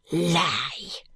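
a hint of a sharp French denial; mildly voiced outcry; crying out. Maid interrupting the detective, sharp denial. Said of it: "cries mademoiselle".

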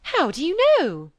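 credulous spinster agog; amazed question. A narrator's reading. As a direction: ask it in astonishment. Phoebe amazed at the gossip.

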